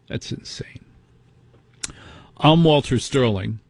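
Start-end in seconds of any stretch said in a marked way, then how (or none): 0.1-0.8 whispered. Real, not acted. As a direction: whisper That's insane under the breath. Quiet closing remark.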